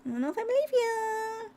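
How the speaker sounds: very high squeaky-style put-on voice; playful high doubt. Streamer teasing a viewer who claims a push-up, high mock doubt.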